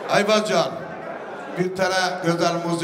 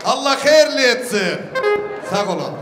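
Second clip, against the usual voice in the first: booming wedding announcer; 5 semitones higher than usual. Real, not acted. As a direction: proclaim each gift to the hall. Host announcing gifts to guests. (düğünde hediyeleri gür sesle ilan eden sunucu)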